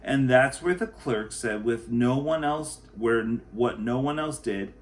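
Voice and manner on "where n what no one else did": plain reading voice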